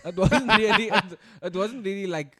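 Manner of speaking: words broken by laughter; laughing. Real, not acted. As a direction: laugh through the line. Laughing while telling.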